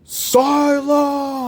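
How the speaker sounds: Dumbledore's booming command, high and loud; booming shout. Acted. Headmaster silencing the panicking students, booming shout. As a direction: boom it out to silence a panicking hall. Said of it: "his loud, booming voice".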